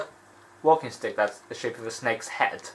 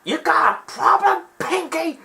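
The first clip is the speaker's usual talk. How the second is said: much higher, slow squawky parrot voice; Iago taunting; slower than usual. Retold or imitated. Iago mocking the flamingo, in a high cocky bird voice. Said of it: "he's like".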